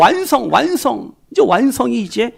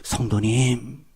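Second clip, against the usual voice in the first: tender assurance; 8 semitones lower, 5 dB quieter than usual. Real, not acted. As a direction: reassure them gently, like a promise.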